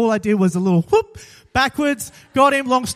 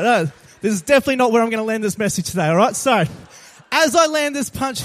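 normal talking voice

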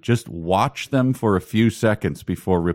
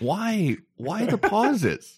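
much higher, drawn-out "why", half comic; slow, higher incredulous complaint; exasperated bafflement; 7 semitones higher, slower than usual. Real, not acted. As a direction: plead in mock disbelief, slowly. Baffled by the book's pauses.

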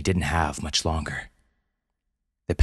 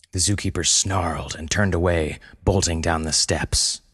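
ordinary delivery

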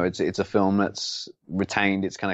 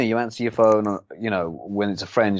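ordinary delivery

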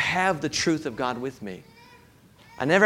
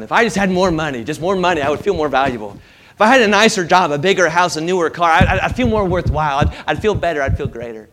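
louder, higher wishful craving; 4 semitones higher, 10 dB louder than usual. Retold or imitated. Preacher voicing the crowd's longing for more, louder and eager.

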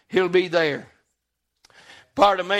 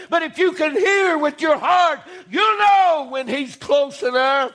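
preaching; 5 semitones higher than usual. Acted. Preacher urging the congregation, impassioned. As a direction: preach it out with fire.

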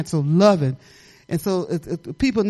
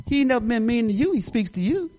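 ordinary talk

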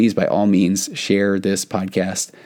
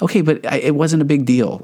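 higher-pitched defensive protest, lightly voiced; mildly defensive, pitched up; defensive husband; 6 semitones higher than usual. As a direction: imitate a husband playing it down, pitch raised. Voicing husband excusing his hurtful joke.

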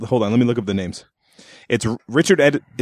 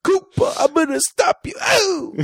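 high, strained comic voice with laughter; squeaky mock tough-guy bit cracking into laughs; Andrew Dice Clay impression; much slower than usual. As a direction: ham up a brash comedian, laughing through it. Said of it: "Andrew Dice Clay".

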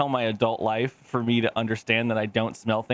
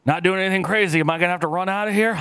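mock boss banter; 6 semitones higher, faster than usual. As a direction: play it casual and jokey. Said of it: "And he's like".

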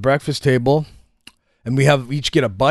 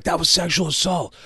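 slightly strained, higher mock protest; retold shocked accusation; 5 semitones higher than usual. Whole rehab reacting to the stunt, mock-appalled.

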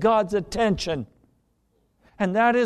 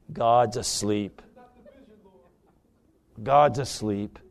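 low hushed refrain; 8 semitones lower than usual. Acted.

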